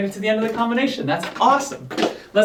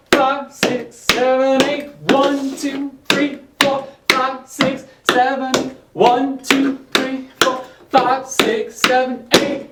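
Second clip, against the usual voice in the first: higher, bright counted beat; counting dance beats; 5 semitones higher, much slower than usual. Shown as a demonstration.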